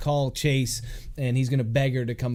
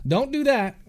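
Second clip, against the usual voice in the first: playful warning; 10 semitones higher than usual. Acted. Chase scolds pouting girl.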